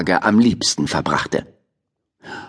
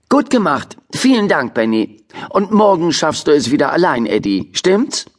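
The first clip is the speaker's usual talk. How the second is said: teacher voice; 6 semitones higher than usual. Acted. Teacher praises and nudges Eddie.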